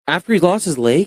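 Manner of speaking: high, rising disbelief; incredulous question. Real, not acted. Podcast host amazed at a soldier's comeback, incredulous.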